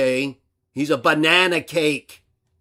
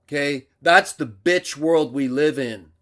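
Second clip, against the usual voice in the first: usual speaking voice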